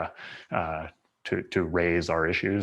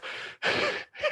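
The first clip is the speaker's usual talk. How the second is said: laughing; 5 dB quieter than usual. Real, not acted. Laughing at the German joke.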